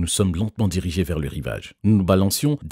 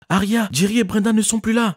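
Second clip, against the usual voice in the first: very high, loud panicked shout; 4 dB louder than usual. A narrator's reading. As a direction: yell it in panic. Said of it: "ai-je crié".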